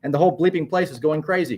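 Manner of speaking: announcer swept up in the action; excitedly. A narrator's reading. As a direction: report a crowd in uproar.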